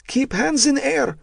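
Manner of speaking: put-on high yelled order in a Russian accent; Russian captor yelling. A narrator's reading. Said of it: "the Russian yelled at them".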